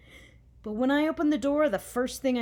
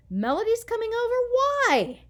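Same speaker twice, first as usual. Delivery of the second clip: high, loud, fast dismay; horrified at the news; 12 semitones higher, 6 dB louder than usual. A narrator's reading. Dismayed child.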